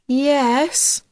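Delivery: high, drawn-out zombie monotone; strange, lifeless trance. A narrator's reading. Hypnotised Podge answering Jack, in a lifeless trance. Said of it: "Podge's voice was strange and lifeless".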